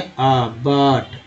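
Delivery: बट with a long, slow, low अ; vowel demo. Shown as a demonstration. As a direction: draw out अ in बट slowly. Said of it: "you को क्या बोलते हैं?". (बट में अ को धीरे खींचना)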